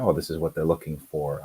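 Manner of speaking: ordinary talk, nothing marked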